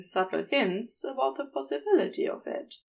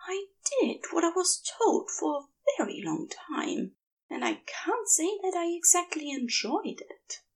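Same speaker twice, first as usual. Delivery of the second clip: whispered confession, raised angel voice; hushed admission; 8 semitones higher than usual. Acted. Angel admitting his secret to his lover, hushed and hurried. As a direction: breathe it out as if someone might hear. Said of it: "the admission is still hushed".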